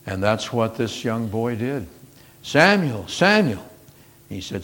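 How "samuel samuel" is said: calling voice of God. Acted. God calling the boy again.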